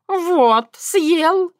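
high triumphant taunt; gloating. A narrator's reading. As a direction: crow it like a cheeky cat. Cat gloating at Крамиш.

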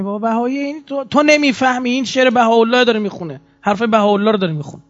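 high-pitched, exasperated retold protest; exasperated insistence. Speaker telling a clueless official about the Baha'i singer, exasperated. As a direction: plead the point as if explaining it endlessly.

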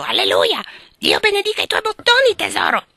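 high, loud parrot squawk; yelling parrot. Acted. Parrot tilting its head and yelling at Lori, squawk.

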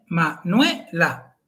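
Manuela said slowly, nue as one syllable. Shown as a demonstration. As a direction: draw out Manuela, ue as a diphthong. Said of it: "la segunda es nue". (Manuela despacio, con diptongo en nue)

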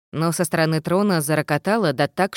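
usual speaking voice

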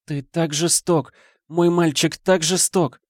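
slow, lamenting ghostly mother; wailing mother. Acted. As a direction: wail it like a grieving mother. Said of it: "запричитала мать".

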